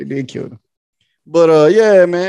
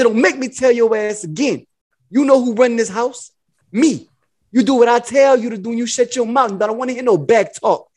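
higher, bossy mock pimp voice; put-on man-of-the-house scolding; Iceberg Slim; 4 semitones higher than usual. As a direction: lay down house rules like a pimp. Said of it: "I was talking like Iceberg Slim".

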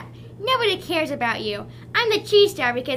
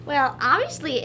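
ordinary talk, nothing marked

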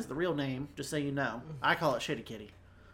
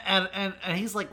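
ordinary talk

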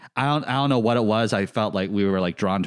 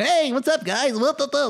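squeaky, very high cartoon-guy voice; high-pitched goofy impression. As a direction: squeal it like a giddy hype guy. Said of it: "Did you just Ronald McDonald me?".

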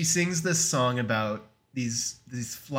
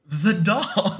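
high, louder mock-surprised "doll?" with a rising lilt; Mitchum's surprised question; 7 semitones higher, 6 dB louder than usual. Podcaster imitating the preacher's fake surprise, high and loud. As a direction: play the villain pretending to be startled. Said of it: "a really good delivery from Robert Mitchum".

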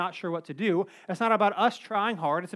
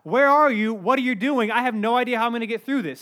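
loud panicked plea, pitched up; freaking out; 3 semitones higher, 7 dB louder than usual. Acted. Preacher acting out someone desperate to God, freaking out. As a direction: cry out like you're freaking out. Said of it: "they were freaking out".

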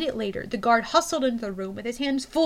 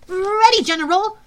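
eager guard; 8 semitones higher, 9 dB louder than usual. Acted.

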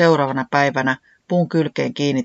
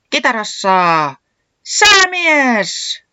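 slow, drawn-out MC shout climbing very high; howled announcement; 8 semitones higher, 7 dB louder, much slower than usual. A narrator's reading. Puuhis introduces the band's guitarist, howling it out. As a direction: howl out the guitarist's name. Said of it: "Puuhis ulvoi niin".

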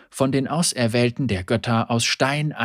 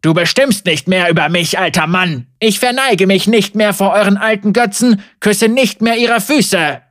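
defiant shouting; 7 semitones higher, 8 dB louder than usual. Acted. Heretic defies the old priest.

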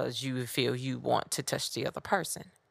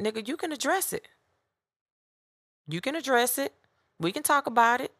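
heated retort; 9 semitones higher, 4 dB louder, faster than usual. Retold or imitated. Confronting the man in line.

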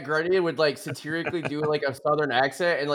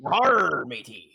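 pirate; 2 semitones lower, much slower than usual. Pretend. Player joking about scurvy, pirate voice. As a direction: do a quick mock pirate.

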